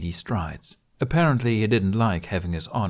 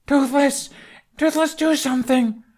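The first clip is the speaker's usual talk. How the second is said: high, louder panicked shout; desperate cry; 4 dB louder than usual. Acted. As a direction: beg the dragon to act. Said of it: "Hiccup cried".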